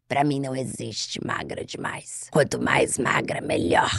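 low, slow smoker voice; smoker's voice. Retold or imitated. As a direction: drag it out like a heavy smoker. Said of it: "Voz de fumante, falou assim".

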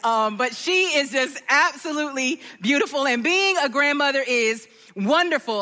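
ordinary delivery

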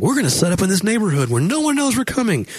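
cheerfully oblivious leader; lightly voiced eager organizer; mock organizer. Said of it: "So it's like".

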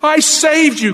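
high, protesting cry. A narrator's reading. Bitten woman to the snake, dramatized outcry. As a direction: exclaim it as the betrayed woman, pitched well up. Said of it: "cried the woman".

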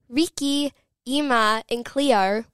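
imitated Australian, Cleo ending in an R sound; Australian accent. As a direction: mimic the show's strong Australian accents. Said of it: "really, really Australian accents".

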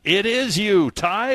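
high, loud gloating reveal; gleeful announcer. Acted. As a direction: crow the news at him.

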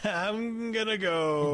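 high, soft, drawn-out excuse; sheepish getaway. Pretend. Co-host playing along with the awkward store exit, sheepish and drawn-out. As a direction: slip away on a stretched, high murmur.

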